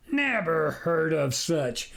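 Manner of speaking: put-on slow, amused old farmer; a lightly voiced chuckling farmer. Farmer chuckling at the stranger's claim, amused. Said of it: "the farmer chuckled".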